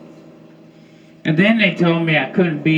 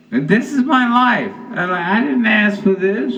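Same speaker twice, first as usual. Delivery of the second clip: much higher, whining complaint; whiny lament; 8 semitones higher than usual. Retold or imitated. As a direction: plead like the victim of fate. Mock lament at the punchline.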